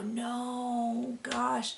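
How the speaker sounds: high, hushed, drawn-out no; soft lament. Retold or imitated. Viewer seeing a favorite miss the finale, softly dismayed. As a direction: quietly mimic a heartbroken viewer. Voicing viewers' let-down at exit.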